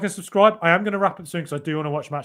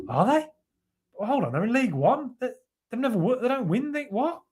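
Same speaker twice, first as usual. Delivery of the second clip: higher-pitched, puzzled questioning; baffled disbelief; 7 semitones higher than usual. Acted. Convinced listener doubting Birmingham City's greatness, baffled disbelief. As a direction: object in confused, halting bursts. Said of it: "And then you're like".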